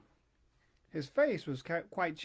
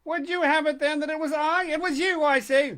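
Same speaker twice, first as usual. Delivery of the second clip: loud, higher, heated insisting; heated retort; 9 semitones higher, 9 dB louder than usual. Acted. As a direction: push back harder each time. Quarrel between two old men.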